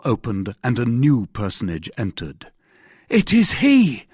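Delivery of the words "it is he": high, loud cry of recognition; raised, urgent outcry; cried out. A narrator's reading. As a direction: exclaim it as if spotting the culprit. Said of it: "cried Bonacieux".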